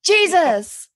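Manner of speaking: sudden high loud yelp; startled exclamation. Real, not acted. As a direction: jump at it, startled.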